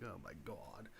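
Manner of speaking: breathless hushed "oh my God"; awed whisper. Retold or imitated. Gem hunter whispering in awe at a stone. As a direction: whisper it like you just spotted treasure.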